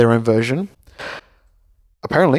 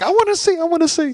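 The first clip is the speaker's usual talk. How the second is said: put-on much higher pleading child voice; a little boy prying at his dad's fingers; whining kid. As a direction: beg like an eight-year-old. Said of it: "being like".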